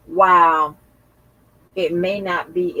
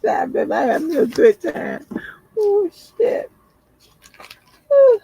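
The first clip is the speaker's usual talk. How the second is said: laughing; 14 semitones higher, 6 dB louder than usual. Real, not acted. Relief after inhaler, laughing.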